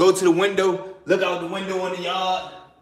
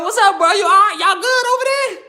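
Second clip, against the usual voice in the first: high, loud check-in from afar; calling over; 13 semitones higher, 5 dB louder than usual. Retold or imitated. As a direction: holler like homies checking on you. Homies calling over to his cell.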